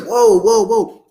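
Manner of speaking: amazed reaction. Acted. Coach acts the audience struck by the question, amazed. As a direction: react as if bowled over. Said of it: "It's like".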